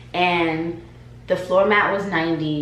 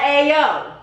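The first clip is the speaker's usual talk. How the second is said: mock street greeting; 6 semitones higher, 4 dB louder than usual. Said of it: "I didn't say it like that".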